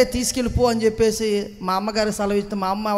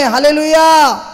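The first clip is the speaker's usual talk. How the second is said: a ringing, loud and higher hallelujah; full-voiced hallelujah cry; 4 semitones higher, 7 dB louder, slower than usual. Real, not acted. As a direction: call it out to the congregation. Preacher cries out hallelujah.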